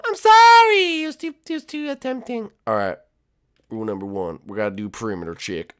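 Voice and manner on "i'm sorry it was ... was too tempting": very high squeaky apology; apologetic little recruit. Acted. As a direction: squeal an excuse to the leader.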